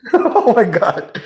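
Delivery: high, drawn-out laugh into words. Real, not acted. Cracking up at their own joke, laughing. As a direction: gasp it out through real laughter.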